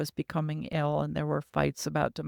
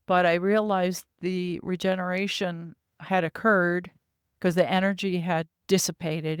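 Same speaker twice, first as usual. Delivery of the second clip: normal talking voice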